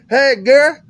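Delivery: high, loud Southern buddy imitation; hammed-up Southern invite, pitched high; Southern friend. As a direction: call out like a rural Virginia pal. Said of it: "he's like".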